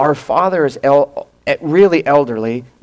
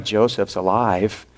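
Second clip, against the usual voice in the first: softer, lower, sinking "Joseph's alive."; dread; 4 semitones lower, 6 dB quieter than usual. Retold or imitated. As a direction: say it as the fear sinks in. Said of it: "on the other hand, they go".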